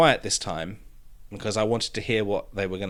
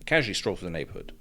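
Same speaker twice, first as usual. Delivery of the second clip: rushed, muttered reread; quick reread; 4 semitones lower, 5 dB quieter, much faster than usual. Shown as a demonstration. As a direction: rattle through it fast and low. Rereading the misread line.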